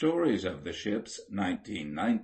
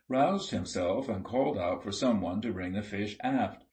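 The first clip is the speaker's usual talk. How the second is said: usual speaking voice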